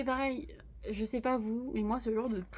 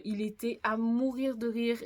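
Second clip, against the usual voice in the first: ordinary talk, nothing marked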